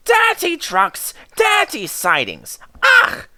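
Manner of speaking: high, squeamish engine voice; disgusted James. A narrator's reading. James recoils from dirty trucks, high and disgusted. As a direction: turn up your nose at dirt. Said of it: "put in James".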